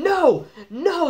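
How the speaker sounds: high, loud mock yell; desperate, high-pitched shouted refusal; Triple H shouting. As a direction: imitate a wrestler yelling back at the crowd. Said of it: "Triple H just shouting".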